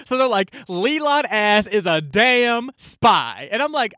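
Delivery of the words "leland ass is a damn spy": slow, drawn-out sarcastic verdict; slow mock accusation. The hosts accusing Leland, slow and mocking. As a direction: stretch out every word of the accusation.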